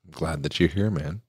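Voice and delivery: very soft, holding back tears; choked-up and quiet; tearful. Real, not acted. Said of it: "we're both crying like a bunch".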